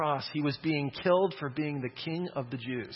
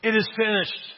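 solemn declaration; 6 semitones higher, 6 dB louder than usual. Retold or imitated. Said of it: "Jesus said".

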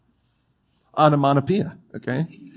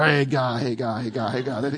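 low growly mumble, sinking softer; grumbled muttering; 3 semitones lower, 6 dB quieter than usual. Acted. Someone stewing over a breakup, low muttering. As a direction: grumble it repeatedly under the breath. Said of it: "You're muttering, you're churning".

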